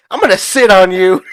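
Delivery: mock furious yell; angry outburst. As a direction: blurt it out loudly, fed up. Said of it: "he was just pissing me off".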